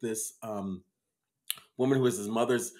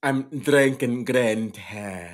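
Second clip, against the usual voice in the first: slow Irish-Jamaican green tea; Irish Jamaican accent; 3 dB louder, slower than usual. Shown as a demonstration. Host shows off his accent slip to his co-host. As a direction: stretch out an Irish Jamaican lilt, slowly. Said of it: "somehow Irish Jamaican".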